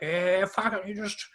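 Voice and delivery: Aussie Ocker voice. Retold or imitated. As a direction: put on a broad Aussie larrikin voice. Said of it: "real Aussie Ocker voice".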